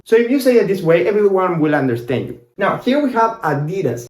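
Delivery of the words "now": normal talking voice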